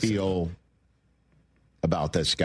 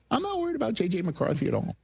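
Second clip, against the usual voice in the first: pitched-up high voice; high register voice; 9 semitones higher, faster than usual. Acted. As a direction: raise the pitch way up. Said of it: "high register take".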